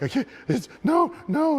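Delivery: retold protest climbing to a high yell; frantic refusal. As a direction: imitate a flustered ref refusing.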